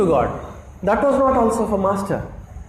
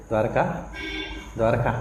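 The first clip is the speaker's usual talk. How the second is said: calling a name softly, lower and softer; tender, hushed call to wake a boy; gentle wake-up call; 8 semitones lower, 7 dB quieter than usual. Retold or imitated. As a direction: softly rouse a sleeping child by name. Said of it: "very gently".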